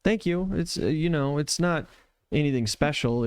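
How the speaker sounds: high, halting modest voice; timid. Acted. Sophia brushes off praise for saving a friend, timid. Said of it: "incredibly timid".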